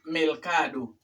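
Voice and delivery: "mercado" said as "melcado"; "mercado" mispronounced with an L before the c; learner's L for R. Retold or imitated. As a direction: imitate the mistake, "mercado" with L replacing R. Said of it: "no es con L".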